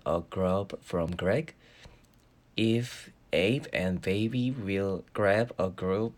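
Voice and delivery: very slow, careful tongue twister, word by word. Shown as a demonstration. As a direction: spell out each word slowly as a drill. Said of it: "발음 연습하거든요".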